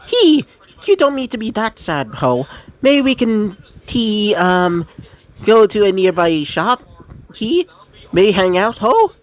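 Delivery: high, bouncy, playful sprite voice; cheery little frost sprite. Acted. Jack Frost cheering sad Izuku up, high and chirpy. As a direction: perk up the sad boy like a chirpy imp.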